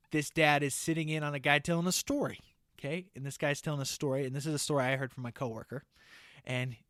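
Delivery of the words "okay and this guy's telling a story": usual speaking voice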